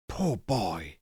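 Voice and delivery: soft pity. A narrator's reading. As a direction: murmur it sadly to yourself. Scrooge pities his younger self.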